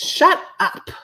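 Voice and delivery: a high, loud outburst that jumps far above her speaking voice; sudden shout. Real, not acted. Shouting her rant's summary at the viewers, high and loud. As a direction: sums up her whole rant at church people with a high, loud shout. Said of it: "I'ma summarize my rant up like this".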